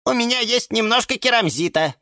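offhand little lemon voice; lightly voiced lemon. A narrator's reading. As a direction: lemon shrugging, offering what he has. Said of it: "пожал плечами".

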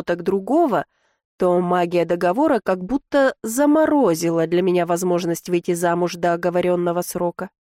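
plain reading voice

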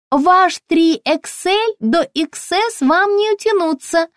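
very high, chirpy shop assistant; high-pitched, ringing sales voice; ringing saleswoman voice. A narrator's reading. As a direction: pipe up brightly through the door. Ringing-voiced saleswoman discusses size.

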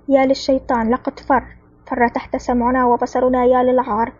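plain reading voice

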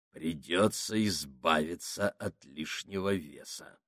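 low, slow, soft menacing voice; ominous voice. Acted. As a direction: murmur it like a dark threat. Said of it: "зловещим голосом сказал Сакс". (зловещим голосом, медленно и тихо)

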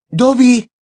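high, loud cry of a name; startled shout. A narrator's reading. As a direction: cry out as if jolted awake. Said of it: "gritó Harry". (grito agudo de sobresalto)

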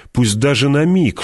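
usual speaking voice

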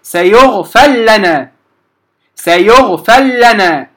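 slow سيغفر لنا, ra fully merged into lam; slow demo of سيغفر لنا with no ra sound; correct merged reading. Shown as a demonstration. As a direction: say سيغفر لنا going straight from fa to a doubled lam. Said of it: "أنتقل من الفاء إلى اللام المشددة مباشرة".

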